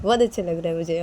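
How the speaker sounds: ordinary delivery, nothing marked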